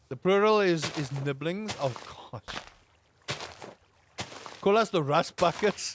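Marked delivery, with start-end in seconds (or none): none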